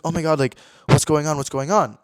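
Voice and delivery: loud, agitated repeated question; retold panic. Spiralling with anxiety about being left out, retold panic. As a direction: blurt it out in mock panic.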